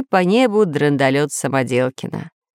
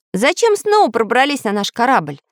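frowning challenge; 7 semitones higher than usual. A narrator's reading. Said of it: "нахмурился Самоделкин".

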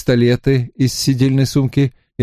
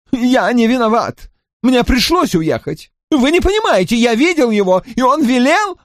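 high, panicked protest; pleading Drawlight; 13 semitones higher than usual. A narrator's reading. Drawlight excusing himself to Lascelles, high and panicked. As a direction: beg to be believed.

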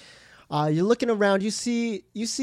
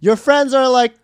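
normal talking voice

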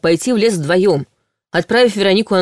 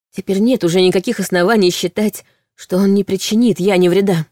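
usual speaking voice

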